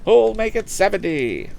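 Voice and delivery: high, brisk auction call selling the boat; auctioneer's patter. Acted. Auctioneer selling a motorboat to the lakeside crowd, high patter. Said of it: "cried Mister Wood".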